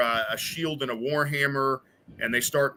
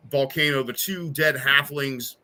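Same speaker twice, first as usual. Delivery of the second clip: usual speaking voice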